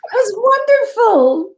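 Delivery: high, loud, slow excited cry; delighted shout. Retold or imitated. Rita reacting to the aunt's news, delighted shout. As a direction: burst out thrilled. Her own delighted reply.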